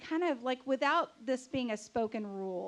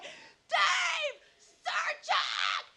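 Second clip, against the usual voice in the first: high, drawn-out street scream; re-enacted long, high-pitched yell; screaming his name; much slower than usual. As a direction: holler up to a window, stretching each word. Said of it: "I start screaming his name on the street".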